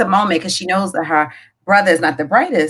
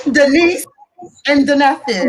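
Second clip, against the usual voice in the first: high, loud, through laughter; cracking up; 7 semitones higher, 5 dB louder than usual. Real, not acted. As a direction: repeat the punchline while laughing hard. Punchline repeated while cracking up.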